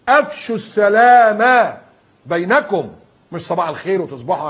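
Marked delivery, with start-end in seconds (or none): 0.1-2.9 slow, louder and higher repeat of أفشوا; rising to a preacher's call; emphatic repeat. Shown as a demonstration. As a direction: hammer the command أفشوا home. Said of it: "قال صلى الله عليه وسلم".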